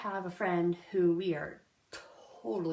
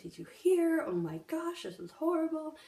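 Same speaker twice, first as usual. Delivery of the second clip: crying sister; 8 semitones higher than usual. Retold or imitated. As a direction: mimic the sister's tearful outburst on the phone. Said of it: "she was crying and she was like".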